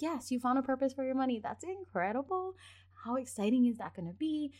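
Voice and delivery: put-on rising, fast praise for a child's win; 1 excited praise. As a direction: cheer the kid on like a proud parent.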